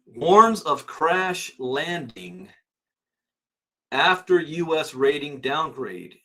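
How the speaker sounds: normal talking voice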